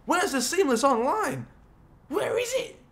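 high-pitched exasperated questioning; frustrated squeal rising on the question. Real, not acted. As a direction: demand it, voice climbing very high. Searching in frustration for missing option.

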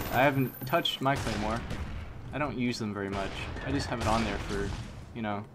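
ordinary talk

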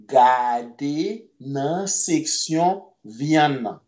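"Gade nan seksyon vyann nan" spoken very slowly, word by word; slow right down so learners can follow; very slow model. Shown as a demonstration. Creole teacher slowly modelling the sentence for students.

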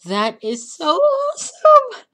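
high, loud, almost tearful cry of joy; overjoyed. Real, not acted. Gamer overcome with joy at his working funnel. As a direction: near tears with happiness.

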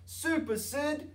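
high, slow triumphant cheer; cheering crowd. A narrator's reading. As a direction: cheer him on. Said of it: "said the crowd".

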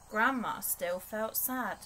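plain reading voice

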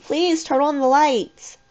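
slow, high, desperate plea; beg, stretching the words out high; drawn-out pleading. A narrator's reading. Corey begging in the blackout, pleading slowly. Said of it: "I heard Corey plead".

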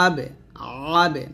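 "আবে" opening with a squeezed throat ayn; pressed ayn. Shown as a demonstration. Teacher showing "like this", pressed ayn on "আবে". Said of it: "আইনের চাপ দিয়ে বলতে হবে".

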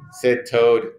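usual speaking voice

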